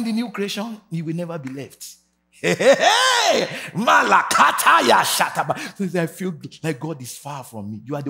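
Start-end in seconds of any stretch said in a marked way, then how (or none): 2.3-3.5 high, slow, loud cry; ecstatic shout. Real, not acted. Preacher erupts in tongues. 3.7-5.6 loud ecstatic tongues; speaking in tongues. Real, not acted.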